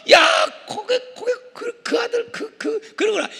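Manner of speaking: high-pitched exasperated sputtering; fed up, angry. Acted. Preacher playing Moses let down by the new generation, exasperated. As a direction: sputter as if too worked up to finish. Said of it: "열 받은 것 같아요".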